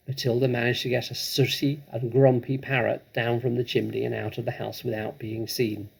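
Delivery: ordinary delivery, nothing marked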